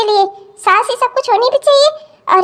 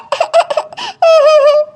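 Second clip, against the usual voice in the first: sobbing; 6 semitones higher than usual. Acted. Daughter sobbing to her mother about her in-laws. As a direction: break down sobbing.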